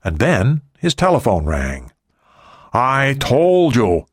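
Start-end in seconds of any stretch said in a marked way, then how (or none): 2.7-4.1 higher, slow, stretched-out reprimand; irritated boss. A narrator's reading. Ri rebukes his receptionist for interrupting, irritated. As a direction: stretch the words out, pitched up, annoyed.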